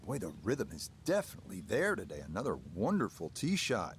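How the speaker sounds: hushed quick golf call; low murmured praise of the shot; golf commentator. Real, not acted. Broadcaster calling a tee shot.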